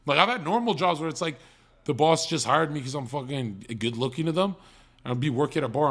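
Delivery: usual speaking voice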